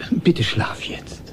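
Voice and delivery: slow, half-whispered request; hushed plea. Acted. Father begs his son to sleep, hushed and slow. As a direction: breathe it out to settle a child.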